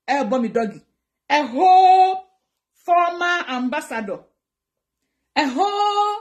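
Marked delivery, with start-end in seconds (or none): 1.3-2.2 WHOLE stretched long, high and loud; drawn-out emphasis. Real, not acted. Vlogger boasting of her status to viewers, WHOLE drawn out. 5.4-6.2 WHOLE held long and high; drawn-out emphasis. Real, not acted. Vlogger repeating her boast to viewers, WHOLE held high.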